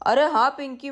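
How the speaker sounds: ordinary talk, nothing marked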